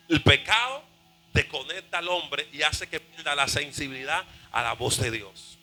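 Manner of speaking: ordinary delivery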